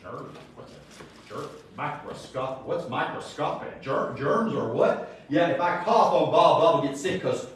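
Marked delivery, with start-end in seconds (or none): 0.0-5.2 soft, breathy whisper of confused disbelief; hushed mocking bewilderment; mock baffled people. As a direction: play the clueless crowd, half whispering. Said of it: "people would go".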